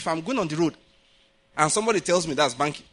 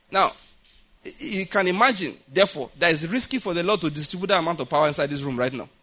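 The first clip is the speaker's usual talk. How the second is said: normal talking voice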